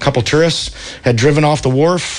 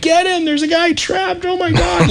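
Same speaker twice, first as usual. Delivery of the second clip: mock panicked screaming. Onlookers up top urging rescuers to the trapped man, mock screaming. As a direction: imitate a panicking crowd in a high voice. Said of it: "people up top were screaming and crying".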